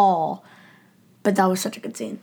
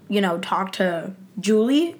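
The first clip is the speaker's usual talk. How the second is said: normal talking voice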